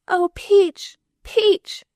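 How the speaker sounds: put-on high, loud child's frantic call; a little girl shrieking for her nurse; frenzied little girl yelling. A narrator's reading. Little girl yelling for her nurse in a frenzy. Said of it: "yelled the little girl's frenzied voice".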